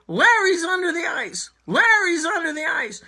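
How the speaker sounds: high panicked scream; frantic yell for help across the ice. Acted.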